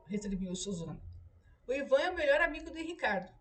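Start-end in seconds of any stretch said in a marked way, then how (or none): 1.7-3.3 sly tone. A narrator's reading. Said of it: "em tom malicioso".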